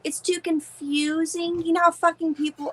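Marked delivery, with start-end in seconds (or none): 0.0-1.6 higher, drawn-out mock complaint; slow, exaggerated echo of an objection; mocking complainers. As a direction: stretch out CONFUSING to ridicule it. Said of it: "People said".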